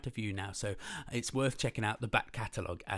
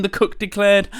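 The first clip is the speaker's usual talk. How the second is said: lively, loud read-aloud storytelling; animated picture-book reading; story narration; 8 semitones higher, 17 dB louder, slower than usual. A narrator's reading. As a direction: read it big and bouncy for kids.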